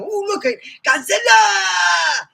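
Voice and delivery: put-on high, loud Japanese-accented cry; Japanese movie character. How the Japanese actor should sound, high shout. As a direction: shout it like a panicked Japanese film extra. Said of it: "the guy who looked Japanese".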